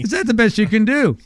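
emphatic haggling ask. Retold or imitated. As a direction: lean on it, pushing for a better deal. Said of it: "you're still trying to get me".

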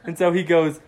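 ordinary delivery, nothing marked

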